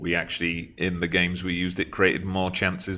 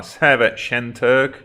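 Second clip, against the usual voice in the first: loud, slow excited call of the name; excited shout; 6 semitones higher, 8 dB louder, slower than usual. Real, not acted. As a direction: cheer him on as he shoots. Excited call during attack.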